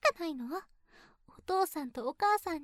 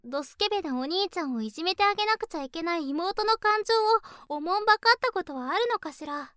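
ordinary talk, nothing marked

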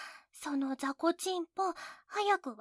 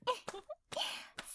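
soft whispered teasing giggle, pitched up; breathy whispered giggle; 7 semitones higher, 9 dB quieter than usual. Acted. Teasing the older brother, a whispered giggle. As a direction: giggle under your breath at him.

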